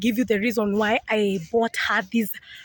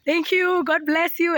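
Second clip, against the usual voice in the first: much higher, gushing thanks; imitating excited mom; 7 semitones higher than usual. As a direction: play the overjoyed mother. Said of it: "She was like".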